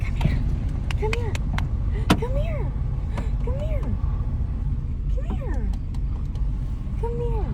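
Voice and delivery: puppy-calling voice. Real, not acted. Calling the lost dog from the car, high coaxing voice. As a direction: call the scared dog gently, high and sweet.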